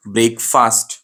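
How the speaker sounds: BREAK-FAST as two full words, "ब्रेकफास्ट"; wrong pronunciation. Shown as a demonstration. Teacher shows students the common mistake, wrong pronunciation of "ब्रेकफास्ट". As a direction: say "ब्रेकफास्ट" with long "brayk" and long "faast". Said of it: "ये wrong pronunciation है उसका".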